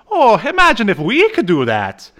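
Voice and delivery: high, loud character voice; excited cartoon character. Host voices Dolph marvelling at the idea, hammed-up excitement.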